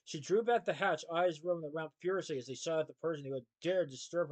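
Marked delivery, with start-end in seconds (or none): none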